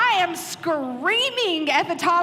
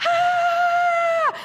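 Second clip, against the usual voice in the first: 1 mock scream; 4 dB louder than usual. Retold panic stuck on wall.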